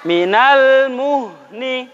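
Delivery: drawn-out, very slow chanted recitation; slow tajwid recitation. Shown as a demonstration. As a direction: stretch each syllable like Quran reading for pupils to copy. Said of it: "Ikuti Ustaz".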